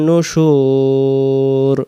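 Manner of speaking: নুশুর held very long and slow; long drawn nushur. Shown as a demonstration. Teacher demonstrates the long pull on নুশুর, slow. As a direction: draw out নুশুর to its full length. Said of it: "নুশুর টান দিতে হবে".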